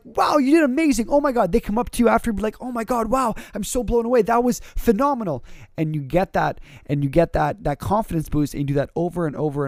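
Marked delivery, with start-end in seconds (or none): 0.0-5.4 excited praise. Retold or imitated. Crowd praising after a speech.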